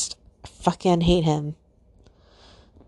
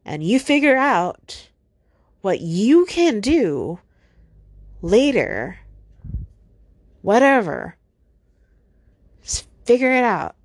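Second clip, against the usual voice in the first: louder, higher, fed-up venting; angry; 4 semitones higher, 4 dB louder than usual. Real, not acted. Talking to her absent boyfriend, loud and fed up. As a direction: snap it off, done with him.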